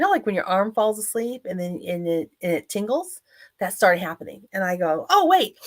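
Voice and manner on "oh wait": higher, louder startled "oh"; sudden realisation. Retold or imitated. Stroke survivor retelling the moment the tingling started, sudden realisation. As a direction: blurt it out as if just realising.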